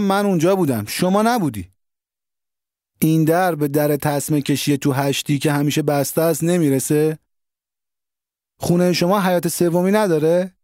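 pleading confrontation. A narrator's reading. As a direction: press him hard, voice climbing. Narrator confronts Ali Agha.

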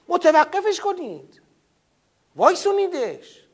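imitating inciters, high and urgent; voicing the unbelievers' incitement; mock agitator. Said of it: "مروج این فرهنگند که بابا".

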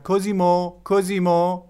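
high, loud calling-out of a name; mother shouting. A narrator's reading. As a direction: call up to a child out of sight.

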